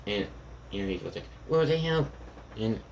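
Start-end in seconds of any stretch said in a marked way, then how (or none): 1.5-2.1 startled, pitched well above usual; startled reaction. Acted. Eri reacts to the clones going up in flames, startled. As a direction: react with high-pitched disbelief.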